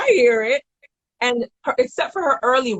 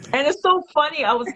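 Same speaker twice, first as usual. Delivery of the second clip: usual speaking voice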